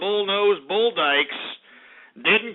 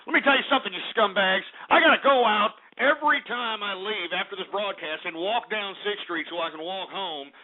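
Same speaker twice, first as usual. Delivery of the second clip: ordinary delivery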